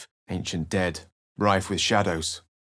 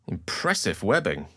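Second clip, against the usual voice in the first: ordinary talk, nothing marked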